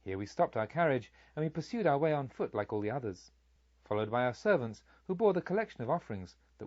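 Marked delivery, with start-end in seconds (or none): none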